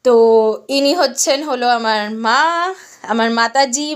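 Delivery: ordinary talk, nothing marked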